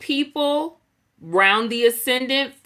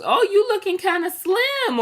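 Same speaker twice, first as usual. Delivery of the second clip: lightly voiced nosy relative; 10 semitones higher than usual. Mocking relative comment.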